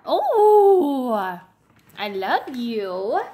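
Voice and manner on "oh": high, loud gushing oh; delighted oh. Real, not acted. Mother gushing over her smiling baby, high and loud. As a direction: burst out with delight.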